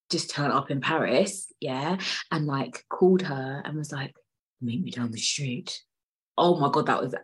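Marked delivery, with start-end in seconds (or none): 4.6-5.8 low, soft murmur as the dad; impression of the dad. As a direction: do the dad, pitched down and quiet. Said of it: "that was an A one impression".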